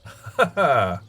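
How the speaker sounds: loud, high laugh falling away; sudden real laugh; short laugh. Real, not acted. Laughs while climbing in the game.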